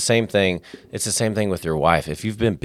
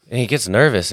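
ordinary talk, nothing marked

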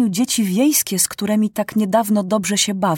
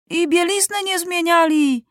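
put-on shouting child; 8 semitones higher than usual. A narrator's reading. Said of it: "krzyknął ktoś".

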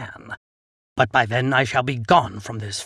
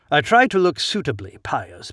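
ordinary delivery

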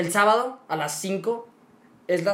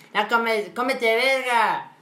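put-on a higher, louder, slow cheeky child voice; mock kid; 4 semitones higher, 4 dB louder, much slower than usual. Seven-year-old boy cursing at dinner, higher cheeky voice. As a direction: play a little boy swearing at the table.

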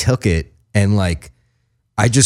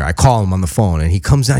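usual speaking voice

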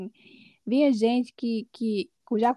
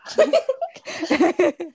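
bright laugh; 7 semitones higher, 4 dB louder than usual. Real, not acted. Laughing at being called sister.

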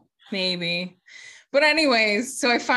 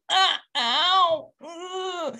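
higher whimpering ow of pain; pained groan; 8 semitones higher, much slower than usual. Retold or imitated. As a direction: wince it out, arm hurting. Pain in fitting room.

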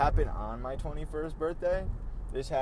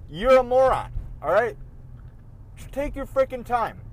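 angry shout; 9 semitones higher, 6 dB louder than usual. Retold or imitated. Yelling at another driver.

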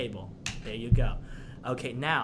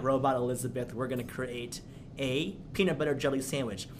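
ordinary delivery

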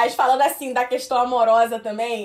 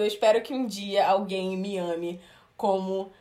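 ordinary delivery

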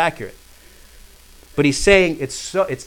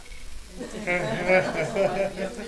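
wordless chuckling laugh; real chuckle at oneself; 4 semitones higher, 4 dB quieter than usual. Real, not acted. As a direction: laugh it off before carrying on. Laughs while getting ready to read.

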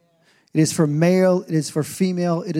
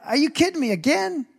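exasperated disbelief; 11 semitones higher than usual. Retold or imitated. Recalling his reaction to headlines.

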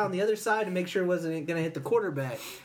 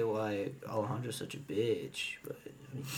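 unvoiced whispered aside, much softer; 9 semitones lower, 10 dB quieter, slower than usual. Real, not acted. Teasing a friend during the podcast, whispered aside. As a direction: lean in and whisper the jab.

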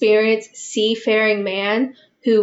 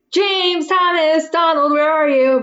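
calling out names, raised voice; 6 semitones higher, 3 dB louder than usual. Retold or imitated. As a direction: call out into the empty house. Keeper calling out for missing men.

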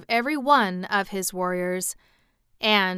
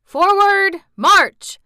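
yelled; 11 semitones higher, 12 dB louder, much slower than usual. A narrator's reading. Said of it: "yelled the colonels".

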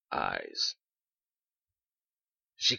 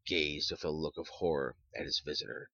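ordinary delivery

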